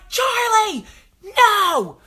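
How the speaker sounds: high loud panicked yell; shouting no. Retold or imitated. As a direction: scream her name to stop her. Mother yelling mid-run.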